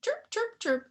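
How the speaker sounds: light mock gecko chirp.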